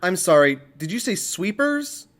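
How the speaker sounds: ordinary delivery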